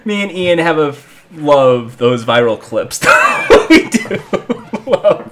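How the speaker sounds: ordinary talk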